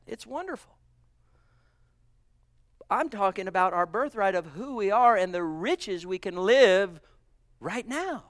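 ordinary talk, nothing marked

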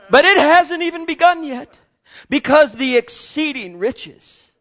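high, loud, ringing proclamation; preaching climax. Real, not acted. Preacher rejoicing before the church, high and loud. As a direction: build to the peak of the sermon.

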